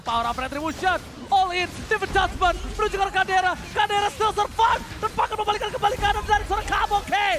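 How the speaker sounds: rising hype, shouting the teamfight; excited shouting. Real, not acted. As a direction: call the wipe out at full volume. Teamfight climax play-by-play.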